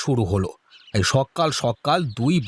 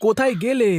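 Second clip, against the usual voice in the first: husband calling his wife, raised and high; 6 semitones higher than usual. Acted. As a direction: call her from the yard.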